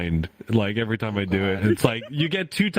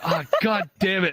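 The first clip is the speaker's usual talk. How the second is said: higher-pitched, comic frustrated curse; mock exasperation; 9 semitones higher than usual. Streamer gives in to husband, exasperated retelling. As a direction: give in with playful annoyance. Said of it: "I'm furious".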